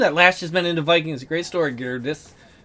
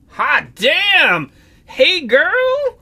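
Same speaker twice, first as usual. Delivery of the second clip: excited; 10 semitones higher, 5 dB louder, much slower than usual. Real, not acted. Player reacts to pretty character.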